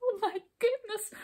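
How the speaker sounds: high, thin tearful wail; weeping. A narrator's reading. Hop scolded by the king, high weeping voice. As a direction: whimper it in a high voice. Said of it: "wept Hop again".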